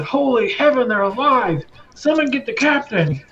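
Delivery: high, astonished exclamation; stunned cry, sending for the captain; jaw-dropped guard. Acted. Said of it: "see his jaw drop when he sees you".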